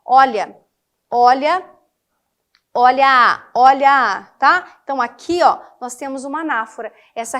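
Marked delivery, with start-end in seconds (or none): 0.0-4.3 each "olha" said loud, spaced out by pauses; loud, deliberate "olha" four times; repeated emphatic olha. Shown as a demonstration. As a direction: hammer every "olha" to show the repetition. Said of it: "repetição da mesma estrutura no início dos versos".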